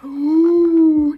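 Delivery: long, loud, high ooh; gasp, then coo loudly in delight; excited gasp. Real, not acted. Gasping over the new products.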